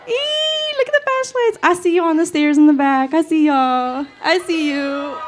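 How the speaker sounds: high, loud squealing delight; thrilled squeal. Real, not acted. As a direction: squeal at the lit-up crowd. Seeing crowd flashlights.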